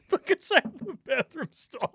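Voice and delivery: ordinary talk, nothing marked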